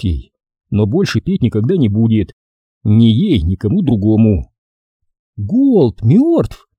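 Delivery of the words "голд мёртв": high-pitched shocked female question; astonished woman; stunned. Acted. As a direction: ask in high, taken-aback disbelief. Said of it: "Она оторопела".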